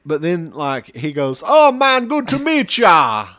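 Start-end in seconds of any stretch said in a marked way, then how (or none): 1.4-3.3 loud, high, slow mock Jamaican accent greeting; fake Jamaican accent. Actor greeting fans in a loud fake Jamaican accent. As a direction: boom out a hammed-up Jamaican accent. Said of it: "the worst fucking Jamaican accent".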